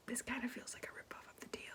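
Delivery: secretive fast whisper. Real, not acted. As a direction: whisper it like a confession, quickly. Spoiler alert.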